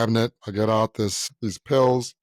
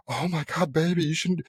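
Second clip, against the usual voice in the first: higher-pitched concerned mom; imitating fussing mom; 6 semitones higher than usual. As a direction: lightly voice a mother fussing over her boy. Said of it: "and she's like".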